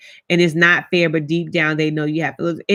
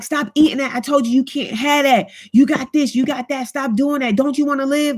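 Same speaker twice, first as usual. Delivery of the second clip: higher, nagging scold at mom; scolding caregiver; 5 semitones higher than usual. Retold or imitated. Daughter scolding her mom about food, higher nagging voice. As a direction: fuss at her like a strict parent. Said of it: "or I be like".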